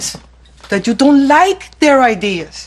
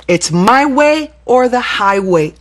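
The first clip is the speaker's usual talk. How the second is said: firm; slower than usual. Shown as a demonstration. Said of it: "important to say".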